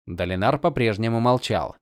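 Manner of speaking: plain reading voice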